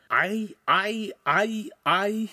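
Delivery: high, stuttered mock reaction; high-pitched oafish stammering; imitating a startled oaf. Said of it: "imitating the big oaf's first reaction".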